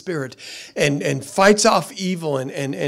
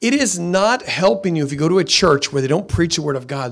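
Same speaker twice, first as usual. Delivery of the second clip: usual speaking voice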